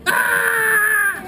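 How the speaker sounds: long, high, loud "aah"; sustained high storytelling scream; running scream. Acted. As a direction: yell it all the way as you bolt.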